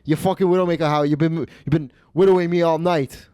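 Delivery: mock insults with dropped Rs; Stefano. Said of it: "And Stefano was like".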